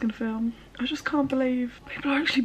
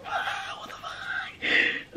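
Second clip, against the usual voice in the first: drawn-out breathy whispered freak-out; whispered panic; 7 dB quieter, much slower than usual. Acted. Vlogger with minutes left before launch, whispered panic. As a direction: whisper it slowly in disbelief. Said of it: "and I'm like".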